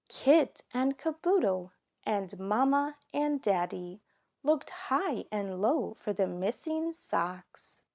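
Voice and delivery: plain reading voice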